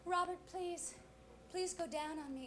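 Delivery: soft mock-passionate pleading; hushed, hammed-up lover's plea; passionate plea, retold. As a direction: plead softly, as if in the heat of passion. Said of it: "in the heat of passion".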